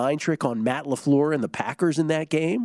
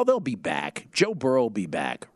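ordinary delivery

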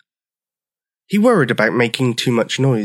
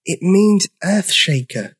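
high, slow little creature voice; tiny voice; 7 semitones higher than usual. A narrator's reading. Dinosaur explaining his name, tiny voice. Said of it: "in a voice so tiny".

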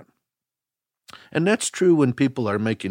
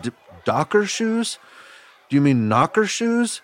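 confused question; 7 semitones higher than usual. Retold or imitated. Confused kid asks back.

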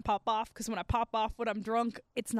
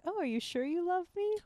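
coy, higher little question; fake-sweet teasing doubt; playing coy; 6 semitones higher than usual. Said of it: "trying to play coy".

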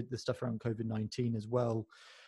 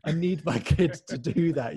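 louder, higher mock-desperate exclamation; envious parents; 4 semitones higher, 7 dB louder than usual. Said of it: "imagine parents listening all over thinking".

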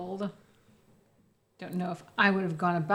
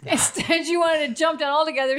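laughing; 7 semitones higher than usual. Real, not acted. Teasing friend while laughing.

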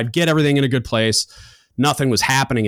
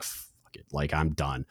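defeated mutter; 6 semitones lower, 11 dB quieter, slower than usual. Retold or imitated. Giving up.

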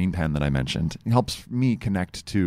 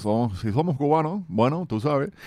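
put-on playful Miami Cuban accent, higher; 5 semitones higher than usual. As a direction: Cuban Spanish with a Miami swagger. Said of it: "say it in a Cuban voice".

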